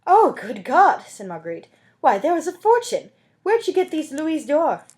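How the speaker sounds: high, loud amazement; astonished exclamation. Acted. Marguerite sees the two gold coins, high and astonished. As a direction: gasp at the sight of gold.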